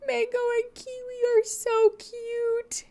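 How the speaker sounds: soft high squeaky coo over cute animals. Real, not acted. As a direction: coo at the pets like babies. Cooing over cute pet photos.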